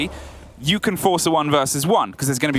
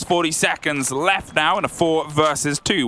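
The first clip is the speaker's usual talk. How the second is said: ordinary delivery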